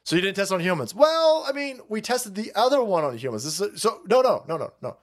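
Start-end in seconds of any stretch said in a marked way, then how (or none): 0.9-3.4 high mock "well", pleading excuse; mock defensive official. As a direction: play the evasive official, whiny. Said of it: "They're not even pretending like".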